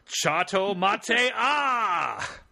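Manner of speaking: slow drawn-out battle shout; anime attack cry. Acted.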